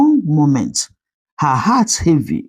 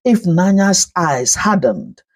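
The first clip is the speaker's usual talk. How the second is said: ordinary delivery, nothing marked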